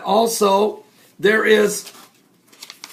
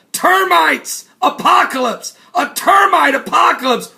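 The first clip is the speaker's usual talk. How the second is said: hyped headline; 6 semitones higher, 4 dB louder than usual. Acted. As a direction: sell it like a tabloid banner. Hyping headline.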